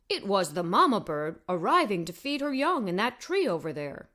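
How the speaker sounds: a hint of dog voice, trailing off; lightly voiced talking dog. Dog explains the bird.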